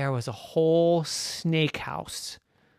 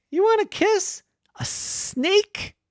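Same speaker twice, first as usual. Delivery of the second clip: much higher, slow disbelief; astonished zookeeper; 6 dB louder, slower than usual. Acted. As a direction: gasp it in disbelief.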